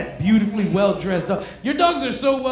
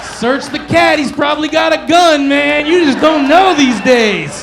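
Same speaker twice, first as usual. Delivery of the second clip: loud high mock cop bark; high loud mock police order; mock cop shout; 6 semitones higher, 12 dB louder than usual. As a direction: yell like a paranoid cop. Mocking cop about cat.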